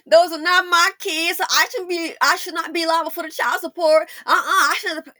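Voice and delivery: a hint of a tearful protest. Imagining [name] crying about child support, mildly whiny. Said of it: "one video of [name] going".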